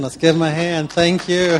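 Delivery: loud, high, cheering the sharer on; rallying applause. Real, not acted. Leader calls the group to applaud the sharer, excited and loud. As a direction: pump the room up for applause.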